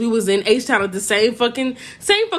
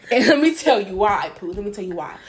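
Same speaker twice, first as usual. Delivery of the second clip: normal talking voice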